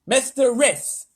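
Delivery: high, loud, slow guess; guessing president. A narrator's reading. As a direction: try the name hopefully.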